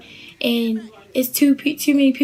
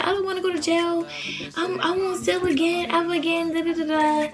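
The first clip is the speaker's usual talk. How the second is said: higher-pitched mock pleading; imitated crying plea; 6 semitones higher than usual. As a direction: plead like a tearful friend, imitating her. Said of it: "and crying, crying".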